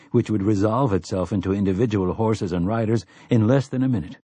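usual speaking voice

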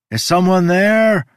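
high, loud desperate shout. A narrator's reading. Overwhelmed by deafening music, shouting for someone. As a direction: shout for help over painful noise. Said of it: "I shouted".

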